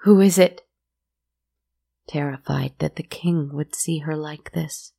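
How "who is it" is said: high, loud frightened cry; cried out. A narrator's reading. Terrified woman crying out to the knock at the door. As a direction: call out in terror at the door. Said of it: "She cried out".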